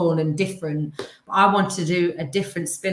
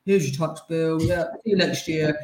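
dull accountant voice. Retold or imitated. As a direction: drone it like a dreary accountant. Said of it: "a person that's a bit like".

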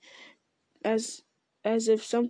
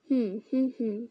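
thoughtful hum. A narrator's reading. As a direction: hum while searching memory. Ent humming while remembering.

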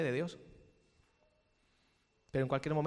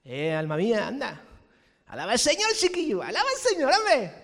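louder, higher mock Andalusian urging; southern Spanish accent; 6 semitones higher, 10 dB louder than usual. Preacher urging his soul in a hammed-up Andalusian accent. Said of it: "en un tono más pa' abajo".

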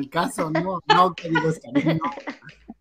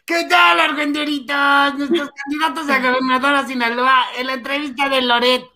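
mock news host; 9 semitones higher than usual. Greeting the audience, mock news presenter. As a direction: overdo a chirpy TV presenter. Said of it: "yo necesito hablar así de".

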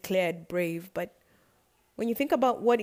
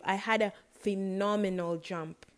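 ordinary talk, nothing marked

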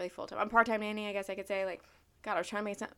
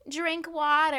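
high, loud parroting of others; mocking advice; 9 semitones higher, 6 dB louder, slower than usual. People advising her on chapped lips, mocked. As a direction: parrot it back mockingly, pitched up. Mimics followers' obvious advice.

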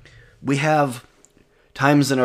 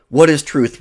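sharp, louder scornful retort; 4 semitones higher, 6 dB louder than usual. A narrator's reading. Pilate retorts to Jesus, louder and higher. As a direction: Pilate throws the question back, higher and louder. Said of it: "retorted Pilate".

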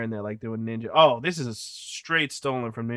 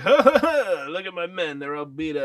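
mock villain laugh, high and loud, then settling; gloating villain; 6 semitones higher, 4 dB louder than usual. Villain reacting to his beaten-up men, mock gloating. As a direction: imitate a bad guy crowing over his henchmen.

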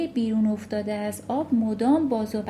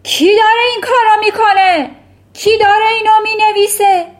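much higher and louder panicked bellow; shouting; 12 semitones higher, 14 dB louder, faster than usual. Acted. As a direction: yell at the whole class. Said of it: "داد کشید".

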